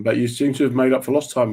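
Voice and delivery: ordinary talk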